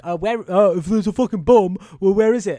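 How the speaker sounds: hammed-up urgent outburst; mock panic. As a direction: play the agitated crowd demanding answers. Acts out bomb panic.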